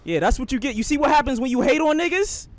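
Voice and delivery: high, loud, rising gloat; gloating taunt. Real, not acted. Gloating at enemy after skip.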